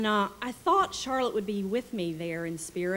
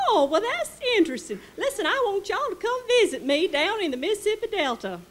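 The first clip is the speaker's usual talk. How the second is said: high-pitched delighted Delta lady; Southern lady voice; 13 semitones higher, 7 dB louder, faster than usual. Retold or imitated. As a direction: play the gushing Mississippi hostess. Charlotte voiced in retold story.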